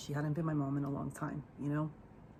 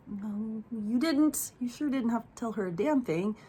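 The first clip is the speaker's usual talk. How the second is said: raised pitch, retold scolding; indignant retort; 6 semitones higher, 3 dB louder than usual. Niece confronting aunt for telling grandmother, indignant retort. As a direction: push back with higher-pitched indignation.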